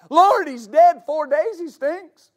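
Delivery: high, loud, drawn-out protest; mock whining complaint to the Lord; protesting objection. Acts out the objection to Jesus.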